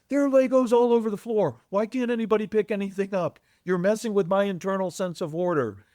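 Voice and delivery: hammed-up furious father bursting in; ranting dad. Dad ranting at home mess.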